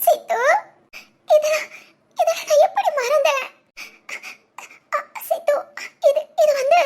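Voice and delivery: half-laughing, half-crying with emotion; choked-up happy crying; laughing through tears. Acted. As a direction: let the tears break into a laugh. Recalling friend, moved to tears.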